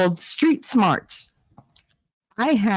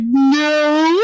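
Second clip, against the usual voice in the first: high, drawn-out wobbly "no"; mock denial; 9 semitones higher, 5 dB louder than usual. Mom denying to son she cried, high mock-tearful no. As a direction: squeal a fake protest, stretched out.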